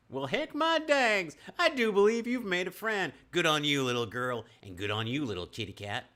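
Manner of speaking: put-on New Mexican accent. Nash praising the girl and kitty, New Mexican accent. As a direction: belt it out like a New Mexican cowpoke. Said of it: "that's my New Mexican accent".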